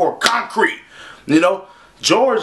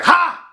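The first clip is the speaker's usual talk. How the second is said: mock jump-scare shout; 14 semitones higher than usual. Acting Trayvon jumping out at Zimmerman with a high shout. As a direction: leap out with a sudden "Ha!". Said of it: "hopped out like".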